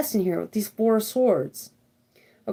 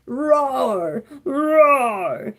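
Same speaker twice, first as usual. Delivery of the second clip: long, drawn-out mock roar; lion roar. Card reader acts the lion on the hunt, mock roar. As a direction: imitate a lion roaring the word. Voices the lion roaring again.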